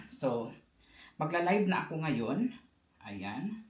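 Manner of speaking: normal talking voice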